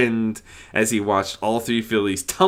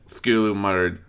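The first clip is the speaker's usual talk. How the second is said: ordinary delivery, nothing marked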